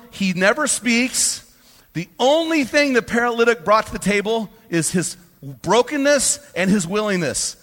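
high, loud and fired up; worked up in real excitement. Real, not acted. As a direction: preach it with rising fire. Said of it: "I get a little fired up".

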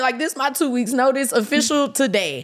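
high, loud, dragged-out wail of quitting; mock quitting. Telling her producer she quits, in a high loud mock wail. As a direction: ham up the melodramatic resignation.

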